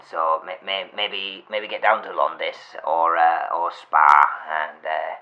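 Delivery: ordinary delivery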